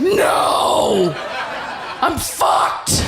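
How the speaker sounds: hammed-up panicked yell; panicked shout. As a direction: holler it in mock despair. Sudden panic after cheering.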